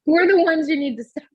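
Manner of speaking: laughing through the words. Real, not acted. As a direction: giggle while talking. Laughing banter.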